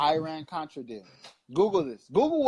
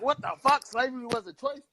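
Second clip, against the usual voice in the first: mock outrage; 6 semitones higher than usual. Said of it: "you, you like".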